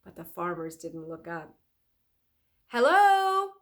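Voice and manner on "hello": loud, high hello; calling. Acted. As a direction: call down to the pickers. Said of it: "he called".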